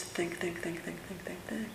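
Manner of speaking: whispered, rapid repeating think. Shown as a demonstration. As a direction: breathe the words like restless chatter. Mind chattering, mocked think think.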